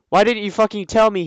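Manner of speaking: hammed-up shouting at her; shouted. Draco shouting at Ebony, hammed-up yell. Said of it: "he shouted".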